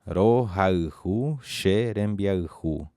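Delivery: "Rohaihu xerembiaihu" read slowly; slow model. Shown as a demonstration. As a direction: present the new phrase slowly. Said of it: "terceira frase que diz assim".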